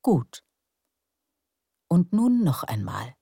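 guided meditation. Acted. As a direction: lull them into calm breathing. Leads breathing relaxation. (ruhige Meditationsstimme)

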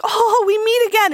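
high, hammed-up greeting; jovial older man. Older man coming up to her again, high mock-jovial greeting. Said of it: "He's like".

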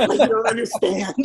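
laughing through the words. Real, not acted. Wrestler joking about match times, laughing through it. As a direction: laugh it out, half pleading.

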